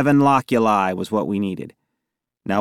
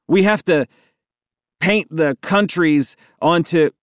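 high, halting improvised excuse; blurted excuse; 6 semitones higher than usual. A narrator's reading. Boy explaining globe to officer, blurted excuse. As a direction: make it up as you go, pitched high. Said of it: "I blurted".

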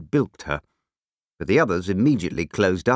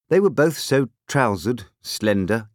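ordinary delivery

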